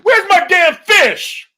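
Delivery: loud, high, drawn-out holler; mock angry yell. Imitating a husband demanding his holiday fish, hammed-up yell. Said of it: "I hear Huggy in the background".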